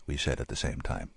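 ordinary delivery

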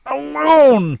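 high, loud, drawn-out scream; shriek. A narrator's reading. Woman striking her rescuer with her fist, full shriek. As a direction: scream it with all her strength. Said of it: "she shrieked".

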